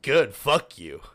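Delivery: defiant retort. Retold or imitated. Worker answering insubordination warning, loud defiant retort. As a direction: throw it back at the boss, acted.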